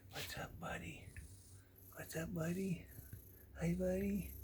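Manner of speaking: soft unvoiced whisper to the cat; hushed greeting to a sleepy cat. Real, not acted. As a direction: whisper so you don't wake him.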